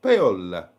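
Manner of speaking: loud, low Italian-accented name; best guess at an Italian "Paola"; falling Italian-accent attempt, pitched low. Shown as a demonstration. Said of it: "that is my best guess at it".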